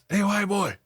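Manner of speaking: high, hailing call; imitated calling out. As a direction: act out a neighbor calling across the hall. Neighbor calls across the hall.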